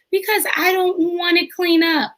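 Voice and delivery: put-on high, pouty child voice; little son sulking. Mother voicing her son refusing to clean up, pouty. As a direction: whine it like a kid avoiding chores.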